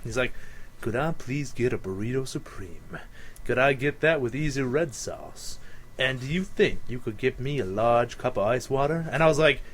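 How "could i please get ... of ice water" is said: deep smooth customer. Retold or imitated. Imitating the customer ordering at the drive-thru, deep smooth voice. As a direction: lower and softer, a suave order at the drive-thru. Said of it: "deepest, most sensual voice".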